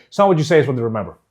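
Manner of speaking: hurried line that doesn't land; rushed, no pauses. Shown as a demonstration. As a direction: run the phrases together quickly. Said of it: "Doesn't land".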